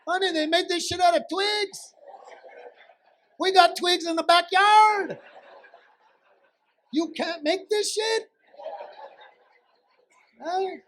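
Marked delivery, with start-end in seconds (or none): 0.1-8.3 high-pitched, exasperated yelling; acted outraged yell across a store; yelling to wife. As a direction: holler for your honey in high-pitched disbelief. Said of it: "And I'm yelling".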